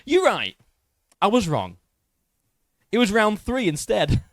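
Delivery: high, loud mock apology; sarcastic concession. Creator mockingly tells his critic he was wrong, sarcastic high voice. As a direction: concede with a smirk, all irony.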